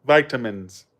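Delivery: "Vitamins" with long i, VY-tuh-mins; American pronunciation. Shown as a demonstration. Repeating the American way, "Vitamins" as VY-tuh-mins. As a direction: open "Vitamins" with the sound of "vie". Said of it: "We say vitamins".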